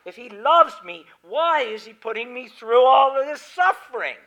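doubting believer. Retold or imitated. Preacher voicing a doubting listener, loud and high-pitched. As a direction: voice a listener's protest at suffering. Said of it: "And you think".

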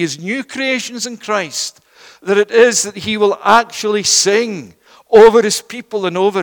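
loud, emphatic sermon delivery; impassioned preaching. Real, not acted. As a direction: preach it out with weight and conviction. Preaches at full force.

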